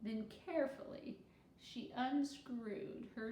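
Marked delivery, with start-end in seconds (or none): none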